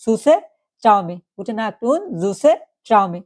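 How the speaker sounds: slow, careful teacher model; slow German demo. Shown as a demonstration. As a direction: say each word slowly for learners. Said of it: "এটা বলুন কি".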